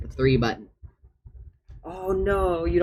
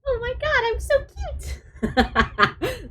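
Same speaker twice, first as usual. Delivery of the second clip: squeaky mouse-like voice, much higher and slow; a cat character voiced like a tiny squeaking mouse, with a laugh; Luna impression; 4 dB louder, much slower than usual. Streamer imitating her cat character, giggly squeak much higher. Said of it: "That's my Luna impression".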